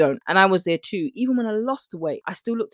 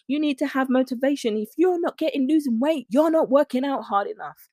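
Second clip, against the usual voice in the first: mock preachy influencer; 7 semitones higher than usual. Mocking influencer message.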